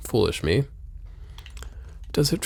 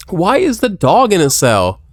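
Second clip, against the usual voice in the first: surprised; 6 semitones higher, 6 dB louder, slower than usual. Real, not acted. Surprised at game dog.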